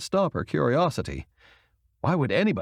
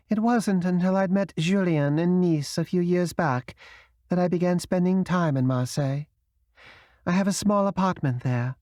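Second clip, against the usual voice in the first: higher, lightly voiced proud woman with a British accent; a hint of a wounded, proud Englishwoman; Madeleine with a British accent; 8 semitones higher than usual. Madeleine telling Camille about Marseille, lightly British.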